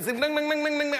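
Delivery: high, repeated chiming syllables; imitated endless echo. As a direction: chime on and on like facing mirrors. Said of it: "à l'infini". (tintement aigu répété à l'infini)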